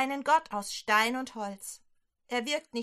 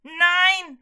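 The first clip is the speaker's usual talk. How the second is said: high, loud startled scream of a king; 7 semitones higher, 12 dB louder than usual. Acted. King Ahab sees Elia suddenly, loud high scream. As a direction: scream the word as the frightened king. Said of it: "schreit er".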